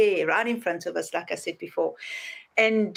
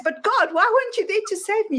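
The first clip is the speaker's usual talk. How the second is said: much higher, wounded question; aggrieved complaint; 10 semitones higher than usual. Retold or imitated. Drowned man complaining to God, high and aggrieved. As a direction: demand an answer from God.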